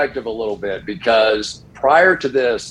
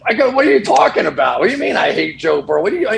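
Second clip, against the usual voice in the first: incredulous disbelief; 7 semitones higher, faster than usual. Retold or imitated. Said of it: "I'm like".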